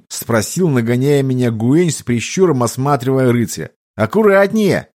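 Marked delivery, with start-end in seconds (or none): 3.9-4.9 warning shout. A narrator's reading. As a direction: yell a warning. (резкий крик)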